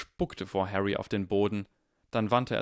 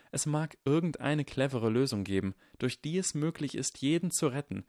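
put-on higher boy's voice, steadily insistent; Harry, earnest and self-blaming; 6 semitones higher than usual. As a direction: reason it through, pitched up.

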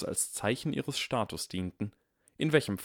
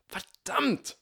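much higher exasperated cry; high, exasperated outburst; frustrated curse. A narrator's reading.

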